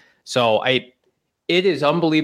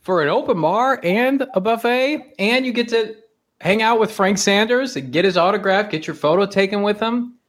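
hype announcer; 8 semitones higher than usual. Pretend. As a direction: sell it like an infomercial host. Hyping the tailgate like an ad.

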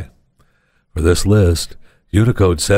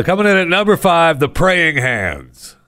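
countdown announcer; 8 semitones higher than usual. Acted. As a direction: announce it like a top-five countdown.

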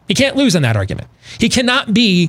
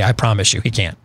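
ordinary delivery

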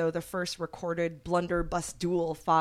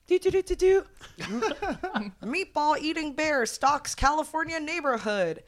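higher, louder fanfare and headline; 1940s newsreel voice; 9 semitones higher, 4 dB louder than usual. Pretend. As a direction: announce it like a wartime newsreel. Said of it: "my best old-timey nineteen forties, uh, voice".

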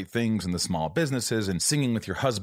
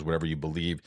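ordinary delivery

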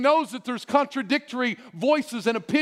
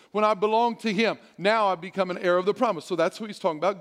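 normal talking voice

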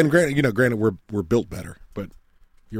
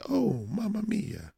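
high, slow caricatured Italian lilt; mock Italian accent; 11 semitones higher, 7 dB quieter, much slower than usual. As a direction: stretch it out in a soft, hammed-up Italian accent. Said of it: "They're like".

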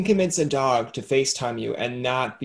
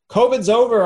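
high loud cheerful call; loud announcement; 9 semitones higher, 8 dB louder than usual. Retold or imitated. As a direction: shout the good news. Announcer of covid over.

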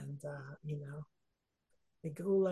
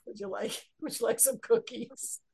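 lightly hammed-up hostess mother with a laugh; 3 semitones higher, 4 dB louder than usual. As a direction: offer treats like a doting mother, amused.

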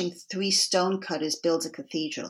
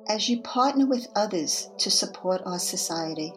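guided meditation. Acted. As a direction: guide listeners gently into reflection. Leads a guided reflection.

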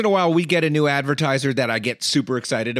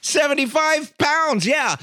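higher, louder, slower with pride; proud excitement; 12 semitones higher, 4 dB louder, slower than usual. Real, not acted. Host telling Paula about weight loss, proudly excited. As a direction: announce it like a big win.

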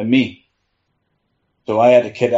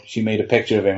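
ordinary talk

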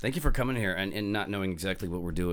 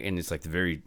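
ordinary delivery

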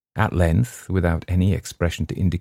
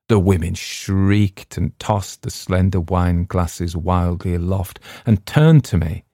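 ordinary delivery, nothing marked

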